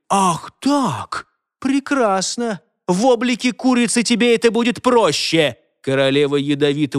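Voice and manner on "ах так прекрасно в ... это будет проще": high, indignant menace; spiteful threat. A narrator's reading. Fairy queen about to cast a spell, spiteful threat. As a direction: threaten her in a raised, pitched-up voice.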